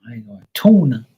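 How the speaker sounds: loud mock Boston accent, syllables stretched; exaggerated Boston syllables. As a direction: lean hard on "tuna" like a Bostonian. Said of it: "they express their, like, syllables a lot".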